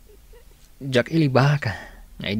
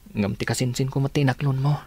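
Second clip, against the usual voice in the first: ordinary talk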